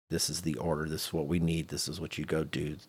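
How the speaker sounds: normal talking voice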